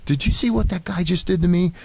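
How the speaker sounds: high, outraged retelling of Reggie; indignant rant. Retold or imitated. Reggie storming into the clubhouse, fuming outrage. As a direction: throw the line like he just got shown up.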